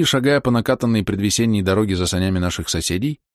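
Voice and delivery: plain reading voice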